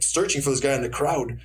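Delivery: ordinary delivery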